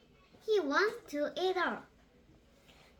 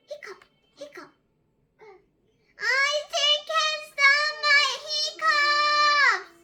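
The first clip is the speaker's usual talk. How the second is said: wailing sadly; 11 semitones higher, 9 dB louder than usual. Acted. Little Pig despairing behind the sofa, high loud wail. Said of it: "tired and sad".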